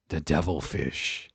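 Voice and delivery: low, slow, menacing announcer; ominous devilfish voice. Pretend. Joking about sinister fish, low ominous voice. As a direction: intone it like a horror trailer.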